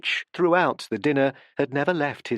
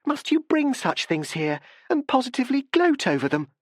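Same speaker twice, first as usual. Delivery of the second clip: cried; 11 semitones higher than usual. A narrator's reading. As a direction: recoil and cry out in disgust. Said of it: "she cried".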